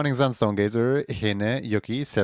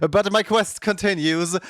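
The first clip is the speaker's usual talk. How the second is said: mock heroic; 8 semitones higher, 3 dB louder than usual. Real, not acted. Mock-heroic quest vow.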